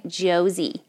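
"Josie" American-style, with a Z; American accent "Josie". Shown as a demonstration. Giving her name so Americans understand, American-accented "Josie". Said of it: "I have to say it in their accent".

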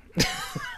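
brief high-pitched laugh; quick high chuckle; real short laugh. Real, not acted. Laughs at the story.

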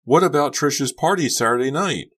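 high, loud wailed question; distraught teen, pitch way up; wailing. A narrator's reading. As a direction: keep wailing in protest. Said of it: "Becca wailed".

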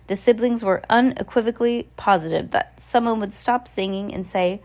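ordinary delivery, nothing marked

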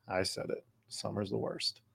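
talking between sips of water; while sipping a drink. Real, not acted. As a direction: speak right off the glass, mouth still wet. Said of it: "Excuse me while I drink water".

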